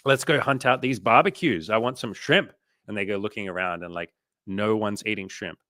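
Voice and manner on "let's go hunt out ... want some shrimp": loud, higher, keen tourist voice; excited visitor hunting for barbecues; eager tourist. Retold or imitated.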